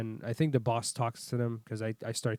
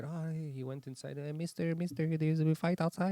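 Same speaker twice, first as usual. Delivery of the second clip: put-on Filipino accent; 4 semitones higher than usual. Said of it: "They go".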